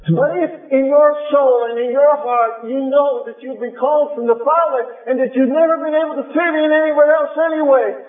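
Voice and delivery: high, urgent preacher's appeal; preaching. Acted. Preacher calling the called to loyalty, rising fervent appeal. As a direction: lift it into a fervent call.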